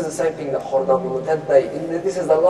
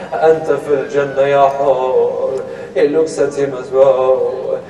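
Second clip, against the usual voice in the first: loud chanted storytelling with long-held syllables; declaimed sing-song lament, swelling in force; lamentation recital; 3 semitones higher, 12 dB louder, much slower than usual. A narrator's reading. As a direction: intone the tale slowly, stretching the line endings.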